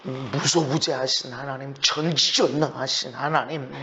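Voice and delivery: 낮고 작은 기도 말투; 나직이 읊조리는 기도 흉내; 기도하는 말투. Acted. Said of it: "기도할 때". (기도를 흉내 내듯 낮고 조용하게)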